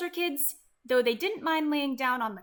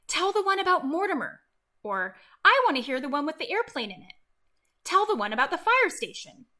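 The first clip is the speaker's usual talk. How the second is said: lightly voiced eager kids; 4 semitones higher, 6 dB louder, faster than usual. Kids' requests retold.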